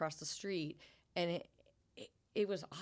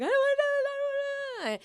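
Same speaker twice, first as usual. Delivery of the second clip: put-on much higher, louder childish whine; whiny reluctance; 11 dB louder than usual. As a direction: whine it like a kid refusing. Whining in barn before event.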